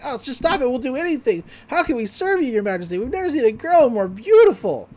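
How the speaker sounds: high, hammed-up pleading; mock grovelling. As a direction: gush like a fawning little minion. Acts gnomes begging and flattering Gideon.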